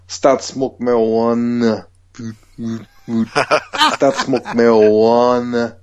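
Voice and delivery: drawn-out reversed-song mimic; backwards speech. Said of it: "I'll say it backwards exactly how it says".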